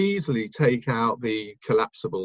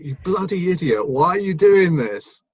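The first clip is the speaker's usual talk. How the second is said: self-scolding; 7 semitones higher, 7 dB louder than usual. Retold or imitated. Recalling the moment he gave away his ticket, exasperated self-scolding. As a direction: berate yourself for a silly mistake.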